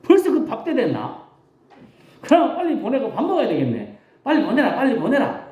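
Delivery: high, chuckling, easygoing; eager casual Jesus. Retold or imitated. Jesus agreeing to send the crowd away, high and laughing. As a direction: brush it off cheerfully, laughing. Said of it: "예수님이 제자들의 말을 들었다면".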